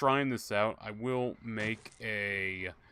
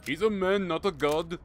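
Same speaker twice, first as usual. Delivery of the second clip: high, loud exaggerated Indian accent; a deliberately bad Indian accent, hammed up; 8 semitones higher, 6 dB louder than usual. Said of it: "I'm doing a bad Indian accent".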